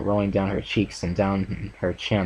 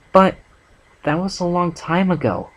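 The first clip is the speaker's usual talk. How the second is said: high pleading protest; a hint of hopeful Fluttershy; 10 semitones higher, 3 dB louder than usual. A narrator's reading. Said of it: "Fluttershy started up but was interrupted".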